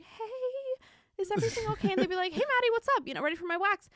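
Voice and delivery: high, tremulous crying voice; a frightened young worker, pitched far up and weepy; nervous. Retold or imitated. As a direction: squeak it out close to tears. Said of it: "I would freak out".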